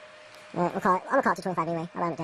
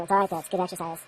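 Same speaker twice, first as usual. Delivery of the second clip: normal talking voice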